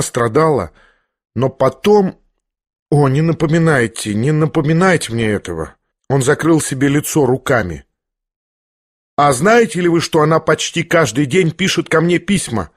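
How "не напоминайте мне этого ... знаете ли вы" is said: plain reading voice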